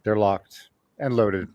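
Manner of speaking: low, slow and hushed; deadpan low-pitched tough talk; mock-serious readiness. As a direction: drop it low like an action hero gearing up. Mock-serious lead-in to the segment.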